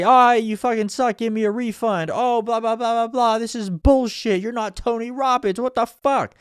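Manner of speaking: high, whiny complaining customer; imitating an annoyed audience member demanding money back; mock heckler. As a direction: sneer like a fed-up attendee wanting a refund. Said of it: "They didn't say".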